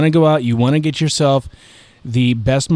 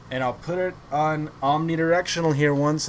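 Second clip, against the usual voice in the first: usual speaking voice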